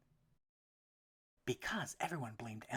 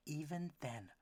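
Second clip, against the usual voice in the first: ordinary delivery, nothing marked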